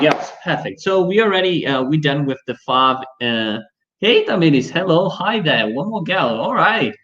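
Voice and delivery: ordinary delivery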